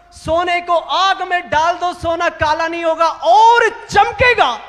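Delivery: impassioned public tribute, driving the key words; fervent preaching. Real, not acted. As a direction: proclaim it to the whole assembly with conviction.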